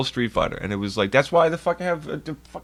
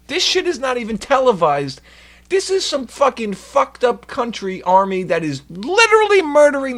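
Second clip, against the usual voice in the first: much higher, louder fuck you; furious shout; 9 semitones higher, 4 dB louder than usual. Real, not acted. Streamer shouting at Blizzard, furious. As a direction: yell it at the company. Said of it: "furious rant".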